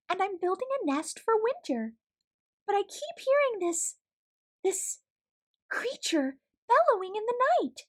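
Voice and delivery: high, timid little mouse voice; a tiny shy creature explaining herself; high squeaky storybook mouse voice; small mouse Joy. Acted.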